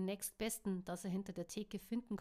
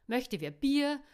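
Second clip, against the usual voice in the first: calling out; 4 semitones higher, 10 dB louder than usual. Acted. Barkeeper calling to the room.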